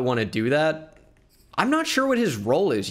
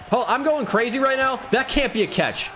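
excited; 10 semitones higher than usual. Real, not acted. Reacting to a big play.

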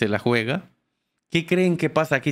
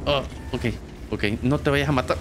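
usual speaking voice

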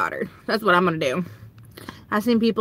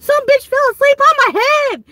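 exasperated outburst; 13 semitones higher, 4 dB louder than usual. Retold or imitated. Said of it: "And I was like".

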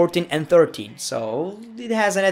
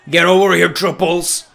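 loud summons; calling out; 6 dB louder than usual. Real, not acted. Referee summons wrestler over, loud call. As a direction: bark it to someone across the ring.

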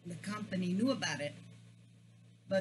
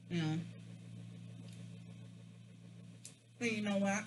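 muffled chewy speech; talking while chewing; faster than usual. Real, not acted. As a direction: mouth full of food. Seen 5: Bites fried snack at 4.3s, then chews with cheeks full.

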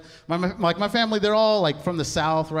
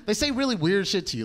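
normal talking voice

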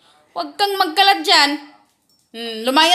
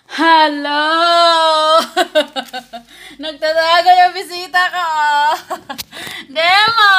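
calling out; 5 dB louder than usual. Real, not acted. Calling her hiding dog Demo to come out, loud pleading. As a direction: coax the sulking dog out with loud calls.

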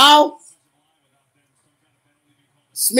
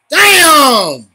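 loud, drawn-out cry of dismay; frustrated outburst; 4 semitones higher, 7 dB louder than usual. Real, not acted. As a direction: burst out loud at the bad play. Reacting to a bad play.

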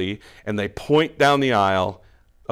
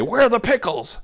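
higher and louder, fed-up demand; exasperated; 10 semitones higher, 5 dB louder than usual. Pretend. Preacher acting a shopper asking the clerk, exasperated and louder. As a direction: snap the question at the clerk, impatient. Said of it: "in an exasperated way say".

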